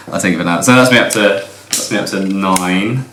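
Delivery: ordinary talk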